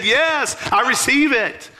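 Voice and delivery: joyful yes. Retold or imitated. Tiffany accepts the prophecy.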